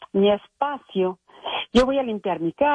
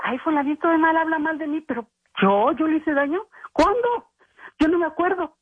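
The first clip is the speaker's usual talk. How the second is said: much higher, breezy and oblivious; carefree mock voice; 6 semitones higher than usual. Host mimicking a cheerful oblivious gossip, higher and breezy. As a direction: play the careless gossip who forgets the hurt. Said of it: "y ahí va muy contenta y dice".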